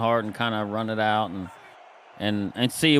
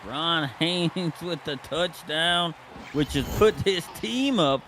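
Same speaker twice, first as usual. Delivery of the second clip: higher-pitched touchdown call; live play-by-play; 6 semitones higher than usual. Real, not acted. Announcer tells viewers of Ron Haynes' touchdown, live play-by-play. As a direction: call the score like a sportscaster.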